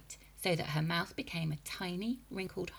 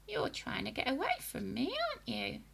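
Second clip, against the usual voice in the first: put-on accusing old woman; 7 semitones higher than usual. A narrator's reading. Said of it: "she said, pointing a finger".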